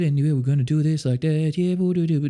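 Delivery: a hint of a scared singer mumbling; timid closed-mouth singer. Coach imitating frightened beginner singers, mock timid. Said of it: "terrified of performing".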